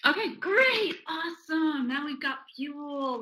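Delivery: high, drawn-out delight; upbeat encouragement. Acted. Coach welcomes the child's anger rating, acted enthusiasm.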